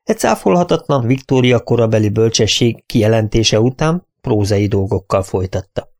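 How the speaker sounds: ordinary talk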